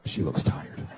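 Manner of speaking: low hushed aside; husband noticing his sleeping wife, hushed low whisper; whispered thought. Retold or imitated. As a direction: breathe it under your breath.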